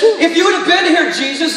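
arguing. Acted. Martha speaks to Jesus in her grief, arguing. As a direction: argue back in an acted complaint. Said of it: "begins to argue or push back".

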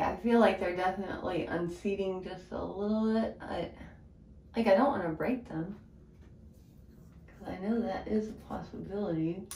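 speaking with lips pulled open; talking while prying at teeth; tool held in mouth. Real, not acted. Seen 1: Purple tool held at teeth and lips throughout, prying off aligners.

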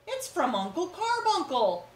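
high, loud excited cry; high excited exclamation. Acted. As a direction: call it out in delight. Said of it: "cried Cousin Elwood".

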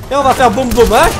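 loud, high gleeful laughter. Real, not acted. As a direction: laugh with mischievous glee. About to blow up enemies.

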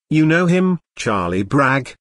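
plain reading voice

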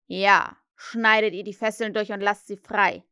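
loud impatient captain's order; fake Hook command. Peter as Hook repeats the order, loud insistence. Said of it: "ahmte er die Stimme von Hook nach".

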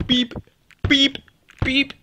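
high robotic beep beep; rabbit beeping. Pretend. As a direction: chirp like a little beeping bunny. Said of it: "that's why I was like, "Beep, beep".

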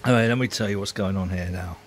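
ordinary talk, nothing marked

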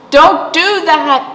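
high, loud warning voice; Oak warning. A narrator's reading. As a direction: warn the little acorn sharply. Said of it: "said Oak".

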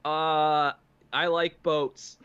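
put-on a nervous teen with nothing to say; tongue-tied. Tongue-tied boy talking to girl.

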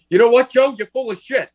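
high, loud pretend Bernie blast; hammed-up Bernie telling Joe off; imagined Bernie outburst. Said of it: "I wish Bernie".

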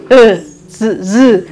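즈 voiced like a buzzing z; soft z sound. Shown as a demonstration. Teacher demonstrating the half-s as z. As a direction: buzz 즈 softly, between s and z. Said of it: "즈처럼 나는 거예요".

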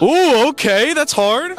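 high, loud teasing ooh; impressed ooh. Real, not acted. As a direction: react like it was smooth. Reacts to cheesy pickup line.

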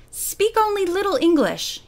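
a hint of an Italian tailor's accent. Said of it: "he said with an Italian accent".

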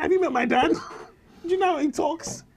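mock disbelief. Mock indignation at producer.

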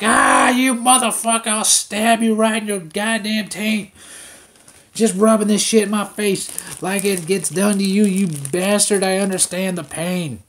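loud, high, mock-furious sister voice; angry dieting sister. Dieting sister yelling at her brother's snack stash, loud and high. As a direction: rant like a jealous sister on a diet. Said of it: "she's probably sitting here watching this right now, like".

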